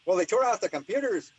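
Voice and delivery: mock Irish man reporting the raid. The lab man on the phone, in an imitated Irish accent. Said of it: "I'm gonna stop doing my Irish".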